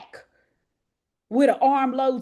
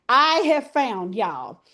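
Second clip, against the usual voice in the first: ordinary talk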